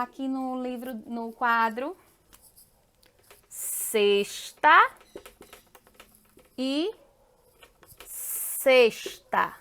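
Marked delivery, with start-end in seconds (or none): 3.5-4.9 sexta said slowly as SESH-ta, rising at the end; identical pronunciation. Shown as a demonstration. Teacher showing a homophone on the board, sexta pronounced carefully. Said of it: "ela tem a mesma pronúncia". 8.1-9.5 sexta sounded out as SESH-ta, falling and lower; identical pronunciation. Shown as a demonstration. Teacher showing the matching homophone, sexta pronounced carefully. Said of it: "ela tem a mesma pronúncia".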